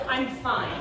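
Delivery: ordinary talk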